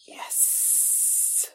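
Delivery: gasp, then a long whispered yes. Real, not acted. Fan seeing the awaited moment happen, gasped whisper. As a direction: hiss out a soft, voiceless yes.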